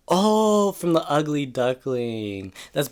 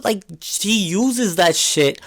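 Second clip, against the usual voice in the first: ordinary delivery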